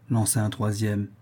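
plain reading voice

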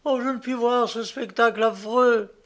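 high-pitched distress; acted anguished exclamation; horrified cry. A narrator's reading. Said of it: "s'écria Annouchka".

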